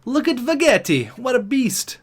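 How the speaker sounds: high-pitched excited admiration. Real, not acted. Gamer admiring his goalie, excited.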